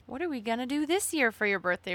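ordinary talk, nothing marked

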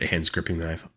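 usual speaking voice

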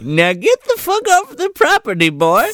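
high granny order; old lady voice. Retold or imitated. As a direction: kick the boy out as an old woman.

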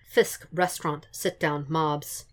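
ordinary delivery, nothing marked

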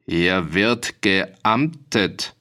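very slow, weighty official pronouncement; dignified. A narrator's reading. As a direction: pronounce it with stately authority. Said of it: "klang es würdevoll über das Pult herüber". (würdevoll und sehr gedehnt)

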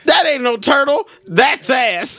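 put-on high, indignant old lady; grandma voice. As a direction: scold it like a shocked grandmother. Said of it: "She said".

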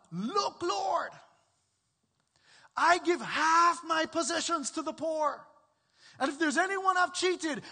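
high and loud, bursting with eager joy; excited. Retold or imitated. Zacchaeus telling the Lord his pledge, excited and loud. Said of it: "do you hear the excitement?".

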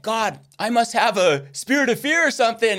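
high-pitched pleading with God; retold desperate prayer. Student telling the Lord about fear, high retold pleading. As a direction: reenact a worried plea. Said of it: "I was so afraid to talk to people".

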